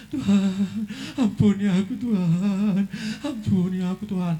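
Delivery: a touch of weeping plea; begging forgiveness. Retold or imitated. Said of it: "Nangis-nangis suami".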